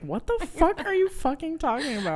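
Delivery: baffled outburst; imitating Ned. Host imagining Ned reacting to the summary, mock baffled. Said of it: "And I'm sure Ned was like".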